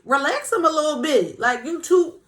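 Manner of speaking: high, loud teasing "relax" to a confident man; mock-scold him to tone it down; hyped-up admiring tease; playful scolding. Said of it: "I be like".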